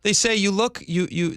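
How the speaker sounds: normal talking voice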